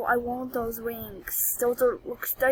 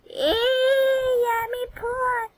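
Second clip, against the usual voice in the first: much higher cartoonish voice; silly squeaky voice; 10 semitones higher, much slower than usual. Repeats joke in a put-on voice.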